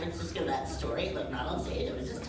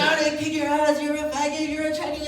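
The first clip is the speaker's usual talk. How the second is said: mock threat; 8 semitones higher, 7 dB louder than usual. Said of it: "he's all up in my face".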